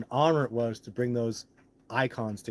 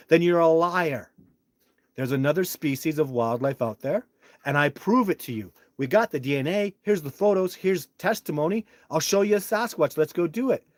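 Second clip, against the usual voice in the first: louder, higher, forceful confrontation; retold angry challenge; 5 semitones higher, 6 dB louder than usual. Challenging the wildlife agency, loud angry demand. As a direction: throw the challenge in their face. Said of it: "They go".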